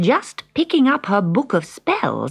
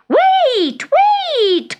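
very high, loud cry; 5 dB louder than usual. Acted. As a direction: call them back in panic. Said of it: "cried the witch".

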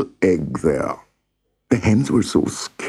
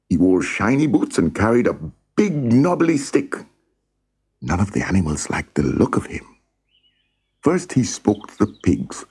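usual speaking voice

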